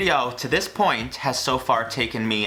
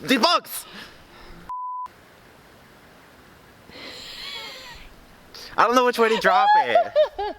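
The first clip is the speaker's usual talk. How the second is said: panicked squeal. Real, not acted. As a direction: squeal in alarm while laughing. Panicking about bugs on finger.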